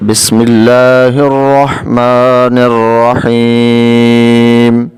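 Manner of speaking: slow, drawn-out tajweed recitation; Quranic recitation. Shown as a demonstration. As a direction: recite it slowly with full tajweed. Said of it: "آئیے ہم شروع کرتے ہیں".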